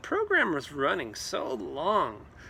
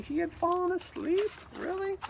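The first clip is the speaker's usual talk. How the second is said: surprised; 12 semitones higher than usual. Real, not acted. Surprised reaction.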